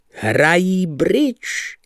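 high, long drawn-out scornful answer. A narrator's reading. Patron sneers at the card players, scornful. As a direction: stretch each word in mockery. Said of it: "zachechtal se potupně".

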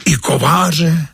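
high, slow, disbelieving gasp of a question; a boy who can't believe his ears; drawn-out amazed question; astonished. Acted. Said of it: "žasne Josífek".

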